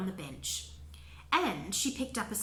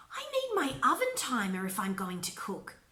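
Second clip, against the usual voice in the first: ordinary delivery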